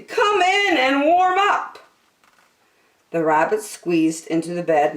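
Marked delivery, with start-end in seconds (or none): 0.0-1.8 high, kind invitation; welcoming. Acted. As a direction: invite them in warmly. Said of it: "said Percy".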